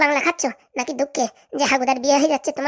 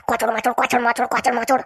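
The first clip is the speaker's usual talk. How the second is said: exaggerated crunching sounds with a changed timbre; loud chewing noises; slower than usual. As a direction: chomp loudly enough for a friend to complain. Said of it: "তুই এত ভারী কচর মচর করতেছিস রে".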